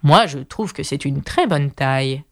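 low, slow, lightly voiced caterpillar in a huff; a hint of an offended caterpillar drawing itself up; mild low indignant creature voice; furious caterpillar. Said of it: "répliqua la chenille d'un ton furieux".

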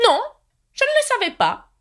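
high, put-out knight; mildly vexed, high; annoyed. A narrator's reading. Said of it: "en prenant un air contrarié".

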